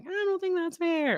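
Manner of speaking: put-on high whiny kid complaint; a child whining about fairness. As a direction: whine it like a sulky child. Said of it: "Say it, don't whine it".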